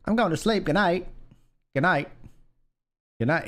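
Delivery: a playful New Zealand impression on "going"; lightly hammed New Zealand accent. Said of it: "Australian, New Zealand would be like".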